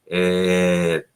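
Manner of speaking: normal talking voice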